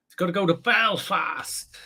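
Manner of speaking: mock Northern Irish Belfast accent; attempted Belfast accent. Shown as a demonstration. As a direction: have a go at a Belfast accent and miss. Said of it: "I can't do a Belfast accent".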